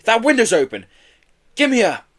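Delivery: high, loud, eager urging; excited. A narrator's reading. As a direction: spot a way in and jump at it. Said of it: "said Joe excitedly".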